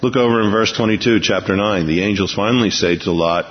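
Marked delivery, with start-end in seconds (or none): none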